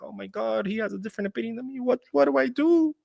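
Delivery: high-pitched, rising panicky voice; hammed-up meltdown, pitch climbing; mock freak-out. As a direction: imitate someone overreacting in helpless hysteria. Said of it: "why do people lose their shit".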